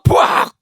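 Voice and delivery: whooshing burst of fire; fire blast noise. Retold or imitated. Imitating the fire falling on the altar. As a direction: shout the fire crashing down.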